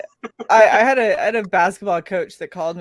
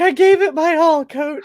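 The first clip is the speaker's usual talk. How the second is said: whiny athlete; 7 semitones higher, 3 dB louder than usual. Retold or imitated. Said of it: "It's like".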